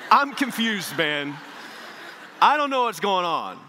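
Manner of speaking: loud, high comic bewilderment; exasperated confusion. Retold or imitated. Preacher replaying his bafflement at a stranger, exasperated confusion. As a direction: throw it out loudly, at a total loss. Acted bewilderment, audience laughs.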